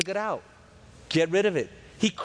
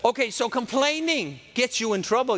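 normal talking voice

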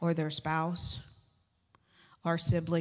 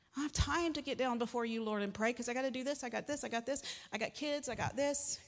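higher, softer rattled-off list of excuses; harried excuse-making; 3 semitones higher, 5 dB quieter than usual. Acted. Busy believer excusing themselves to the Lord from prayer, harried. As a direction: plead too busy, piling up each "I got this".

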